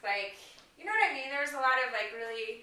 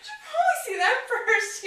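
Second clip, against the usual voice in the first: higher, louder, laughing through it; laughing quote; 13 semitones higher, 6 dB louder than usual. Retold or imitated. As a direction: giggle out the suggestion. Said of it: "I'm like".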